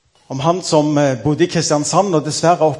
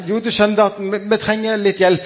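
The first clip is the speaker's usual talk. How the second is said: a lightly raised, eager director's voice; coaxing the new hire, a bit higher; eager zoo director; 7 semitones higher than usual. Acted. Zoo director pleads with jobless man. (ivrig dyreparkdirektør, litt lysere stemme)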